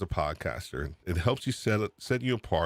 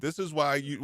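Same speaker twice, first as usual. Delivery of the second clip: ordinary talk, nothing marked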